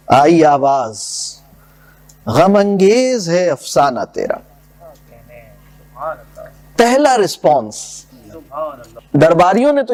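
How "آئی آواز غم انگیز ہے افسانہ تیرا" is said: measured, lower verse recital; poem recitation. Acted. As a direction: recite the couplets with gravity. (شاعرانہ انداز میں پڑھت)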